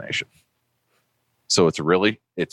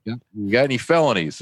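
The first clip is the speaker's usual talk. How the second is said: raised-pitch South Boston cop questioning; South Boston accent; 7 semitones higher than usual. Retold or imitated. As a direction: imitate a South Boston police sergeant. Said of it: "South Boston, right down to his toes".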